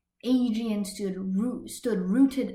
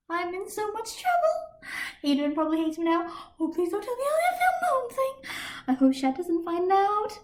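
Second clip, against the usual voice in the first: 1 panicked breakdown; 11 semitones higher than usual. A narrator's reading. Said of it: "having a little breakdown".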